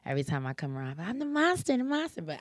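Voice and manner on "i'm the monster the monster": put-on high, teasing kid-game monster; a hint of a spooky monster for kids; playful monster voice. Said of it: "do this thing called the monster".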